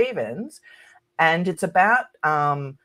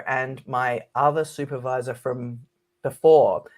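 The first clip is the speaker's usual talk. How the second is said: usual speaking voice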